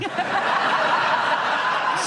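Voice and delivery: high breathless laugh; burst of laughter. Real, not acted. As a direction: laugh out loud. Confusion over dog joke.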